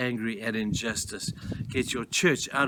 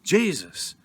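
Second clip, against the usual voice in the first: ordinary delivery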